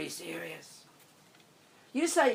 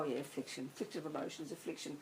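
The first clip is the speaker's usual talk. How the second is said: softer, offhand mock shrug; casual shrug; 3 semitones lower, 10 dB quieter than usual. Mimicking Westerners shrugging off affliction, offhand. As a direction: toss the word off like it means nothing. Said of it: "we go".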